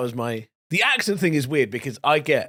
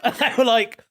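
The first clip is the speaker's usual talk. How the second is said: ordinary talk, nothing marked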